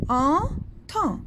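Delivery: entend said as "an-tan" with the final D silent. Shown as a demonstration. Teacher shows children the silent D, careful, clear. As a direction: drop the D at the end of entend. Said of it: "Le D, on la prononce pas".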